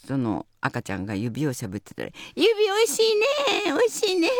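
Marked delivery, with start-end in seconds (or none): 2.3-4.3 very high, louder cooing with a laugh; baby talk. Retold or imitated. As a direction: coo sweetly to a baby. Sanma coos to thumb-sucking baby.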